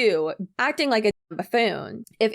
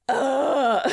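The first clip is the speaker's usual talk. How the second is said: drawn-out groan, pitched higher; exasperated groan; 5 semitones higher than usual. Real, not acted. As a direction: groan as if you can't take any more. Exasperated by the clip.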